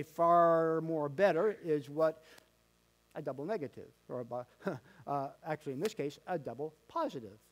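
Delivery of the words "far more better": "far more better" said very slowly, stress on "better"; slow emphasis. Shown as a demonstration. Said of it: "to say".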